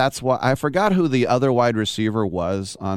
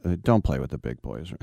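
lower, dry fatherly teasing; dad mocking his grown son's injury, low and dry; dad's deadpan jab; 6 semitones lower, 3 dB quieter than usual. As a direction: tease your limping son in a lowered deadpan. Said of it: "And he goes".

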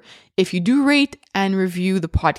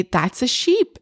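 put-on higher sing-song toddler talk; name the sheep brightly for a baby; parentese to a toddler; 6 semitones higher, slower than usual. Mom naming the sheep for her toddler, higher sing-song.

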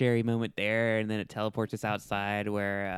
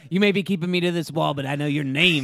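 loud, fast hammed-up exorcist; mock-heroic defiant declaration; dramatic exorcist; 4 semitones higher, 7 dB louder than usual. Parody of exorcism line.

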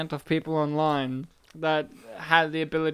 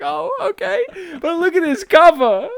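mock surprise; 11 semitones higher, 8 dB louder than usual. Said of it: "And I'm like".

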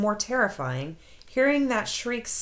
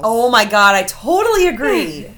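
emphatic agreement; 12 dB louder than usual. Real, not acted. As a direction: agree wildly at full volume. Enthusiastic agreement with tweet.

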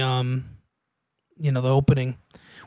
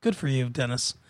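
dry mock praise, much softer; sarcastic; 7 semitones lower, 10 dB quieter than usual. Real, not acted. Sarcastic aside about Dennis.

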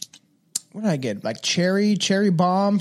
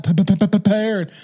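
"prepared" with a machine-gun stutter on the P; repeated popping P before "prepared"; playful stutter. Pretend. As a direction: stutter the first sound of "prepared".

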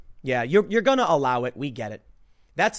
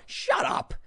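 usual speaking voice

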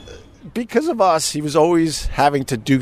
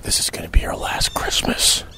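confidential whisper in the ear; imitating a woman's hushed whisper. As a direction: lean in and whisper it. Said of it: "whispers to me".

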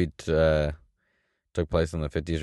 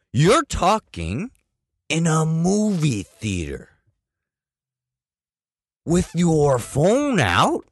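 high, slow, outraged disbelief; incredulous rant; 12 semitones higher, 4 dB louder, slower than usual. Retold or imitated. Retelling a rant at the rude moviegoers, high and outraged. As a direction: stretch out each word in disbelief. Confronting talkers in the theater.

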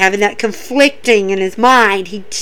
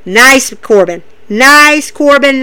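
ordinary talk, nothing marked

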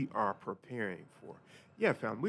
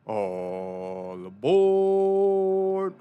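long drawn-out louder call; through a megaphone; 5 semitones higher, 11 dB louder, much slower than usual. Pretend. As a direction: stretch it out like a train conductor. Conductor calls out boarding.

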